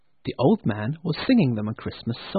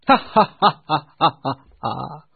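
loud laugh that fades off; hearty laughter; 3 semitones higher, 7 dB louder than usual. Acted. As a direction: laugh till it runs out.

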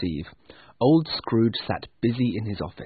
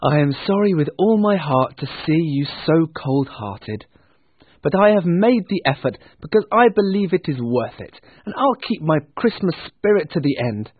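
higher, louder earnest speech; heartfelt nephew; 6 semitones higher, 5 dB louder than usual. Acted. Nephew parting from cold-hearted uncle, heartfelt. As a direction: declare it with lifted conviction.

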